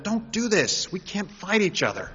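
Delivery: lightly voiced king urging his brothers not to fight; pleading king. King of Judah calling to Israel's king, mildly pleading. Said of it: "was calling to the king of Israel, said".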